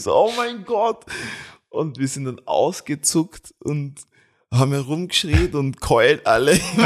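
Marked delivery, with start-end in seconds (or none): 0.1-1.0 excited exclamation. Retold or imitated. Reacting to triplets news.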